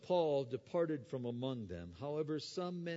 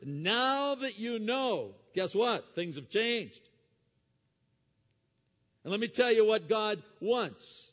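loud, high, ringing sermon voice; preaching at full force; 8 semitones higher, 12 dB louder than usual. Acted. Preacher urging the congregation to repent, loud and soaring. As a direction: thunder it from the pulpit.

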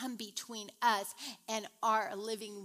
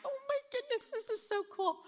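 much higher, thrilled exclamation; excited gush; 13 semitones higher, faster than usual. Retold or imitated. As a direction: gush like a sudden discovery. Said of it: "I'm like".

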